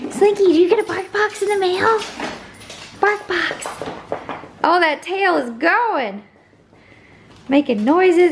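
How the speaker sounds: high-pitched, excited dog-owner voice; excited pet talk. Real, not acted. Owner telling her dog about a bark box, excited pet talk.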